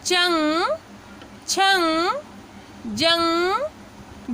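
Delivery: चंग छंग जंग said one by one, nasal ng clear, जंग drawn out; teaching demo. Shown as a demonstration. Teacher repeating the list for students, slow. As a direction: model each for learners. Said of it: "अब इसका उच्चारण हम देखते हैं".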